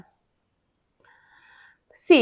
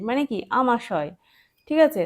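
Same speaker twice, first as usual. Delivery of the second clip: ordinary talk, nothing marked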